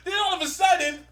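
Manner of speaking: high-pitched incredulous complaint; baffled exasperation. Real, not acted. Recounting a senseless comic plot turn, baffled exasperation. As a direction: raise the pitch in disbelief at the plot.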